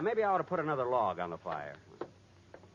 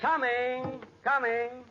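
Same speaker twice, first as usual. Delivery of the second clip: calling out; 9 semitones higher, 3 dB louder, much slower than usual. Acted. Answering the doorbell.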